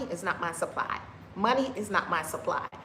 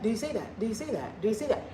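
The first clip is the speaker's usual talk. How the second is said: usual speaking voice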